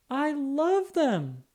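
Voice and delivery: high, slow, gushing I love them; delighted. Acted. Girl thanking Mama for pumpkin earrings, delighted and high. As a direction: swoon over the gift, stretched and high.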